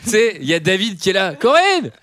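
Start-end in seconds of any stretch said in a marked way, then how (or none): 1.3-1.9 high, loud call through an open door; from another room. Pretend. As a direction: shout it out from the toilet next door. Guy calls from the toilets.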